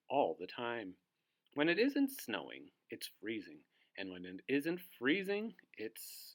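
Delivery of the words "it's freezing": usual speaking voice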